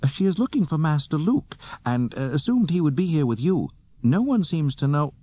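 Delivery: high, prim robot voice; fussy droid. A narrator's reading. Droid explaining Leia's search to Solo, high and prim. Droid keeps relaying its message.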